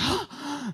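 high, soft imitated gasp; mock gasp. Preacher mimicking the lady's asthma, a soft mock gasp. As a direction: gasp for air.

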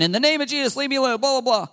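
high-pitched, dismissive imitation of a rote prayer; mock rebuking prayer. Preacher mimicking someone rebuking depression, mock prayer.

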